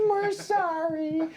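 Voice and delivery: high, slow little apology; drawn-out, high-pitched cartoon "sorry"; sheepish pair. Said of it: "you see both their heads go".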